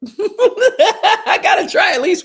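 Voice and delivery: laughing through it, slow; amused at her own persistence. Real, not acted. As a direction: giggle while saying it.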